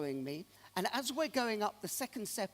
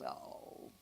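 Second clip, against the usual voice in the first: dejected sigh; 11 dB quieter than usual. Retold or imitated. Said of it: "his shoulders drop, and he goes".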